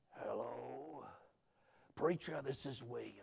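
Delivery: very soft, low, halting phone voice; weak sick caller. Retold or imitated. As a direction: imitate a frail man on the phone, hushed.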